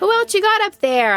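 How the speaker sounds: high-pitched questioning call; calling upward. A narrator's reading. Calling up to bats overhead.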